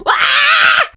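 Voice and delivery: high, loud, crying scream; anguished scream. Shown as a demonstration. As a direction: let out a raw wail of despair. Showing her roadside breakdown.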